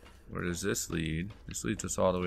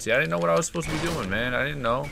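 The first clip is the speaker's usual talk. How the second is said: fast, higher-pitched excuse; flustered self-defence; 6 semitones higher, 4 dB louder, faster than usual. Real, not acted. Player excusing own mistake, flustered self-defence. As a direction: rush it out in protest.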